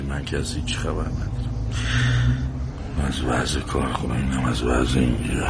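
low, soft mumble; muttering to himself. Acted. Husband complains to himself about factory and home, muttering. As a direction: grumble under your breath, low and quiet. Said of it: "چی میگی با خودت؟".

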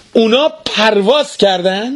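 high, sharp angry question. Acted. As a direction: voice jumps up high in outrage. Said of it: "با عصبانیت صداشو بالا برد و گفت".

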